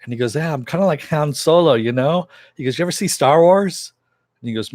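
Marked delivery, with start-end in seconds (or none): 0.4-3.9 higher and louder, eager; excited. Retold or imitated. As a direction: pitch it up with enthusiasm. Cass excitedly asks about Star Wars.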